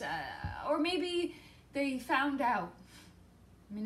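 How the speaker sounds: normal talking voice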